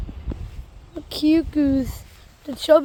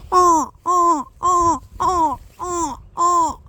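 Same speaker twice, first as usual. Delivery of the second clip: honking voice; 3 semitones higher, slower than usual. Shown as a demonstration. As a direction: honk like a goose. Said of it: "This might sound like a goose".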